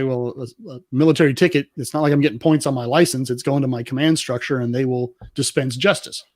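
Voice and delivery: normal talking voice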